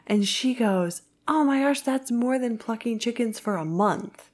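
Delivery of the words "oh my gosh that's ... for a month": higher-pitched, mildly amazed retelling; lightly acted astonishment. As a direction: imitate her wide-eyed amazement lightly. Quoting her amazement at wages.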